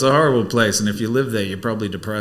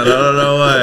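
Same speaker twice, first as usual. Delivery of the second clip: ordinary talk, nothing marked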